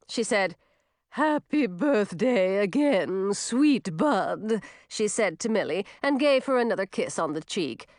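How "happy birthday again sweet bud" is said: slow, drawn-out creature voice; slow, fond fairy farewell; affectionate swamp fairy. A narrator's reading. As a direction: wish a child well in a fairy voice. Swamp fairy bids Millie goodbye.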